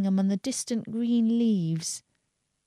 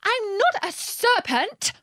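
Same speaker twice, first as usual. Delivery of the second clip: high, drawn-out indignant denial; 12 semitones higher, slower than usual. A narrator's reading. Alice answering the screaming pigeon, indignant. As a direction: protest indignantly, pitched right up. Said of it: "said Alice indignantly".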